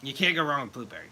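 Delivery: ordinary delivery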